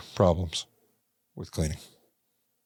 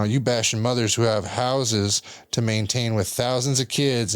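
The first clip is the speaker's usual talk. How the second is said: ordinary talk, nothing marked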